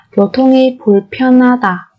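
교통이 불편하다 said quickly; fast native repeat. Shown as a demonstration. Teacher gives the fluent native-speed version, brisk.

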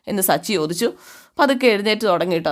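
usual speaking voice

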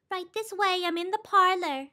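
high, soft and gentle call; lilting, soft welcome in a high voice. Acted. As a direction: sing it out gently from another room. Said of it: "a gentle voice sang out".

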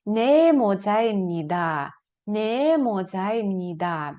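Не модзаємніда slow and falling; slow model answer. Shown as a demonstration. Teacher drills the answer slowly for students. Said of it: "ви скажете".

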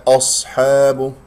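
أصحاب with a thick, backed opening hamza; wrongly heavy hamza. Shown as a demonstration. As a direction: demonstrate the mistake, darkening the first vowel of أصحاب. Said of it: "لا تفخم الهمزة المرققة". (أصحاب بتفخيم الهمزة خطأً)

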